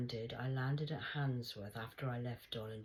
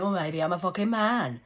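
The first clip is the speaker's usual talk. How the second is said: loud, higher, cutting; defiant retort; 7 semitones higher, 15 dB louder than usual. Retold or imitated. A speaker answering the Rasta man, loud and defiant. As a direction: fire it back at him. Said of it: "I went".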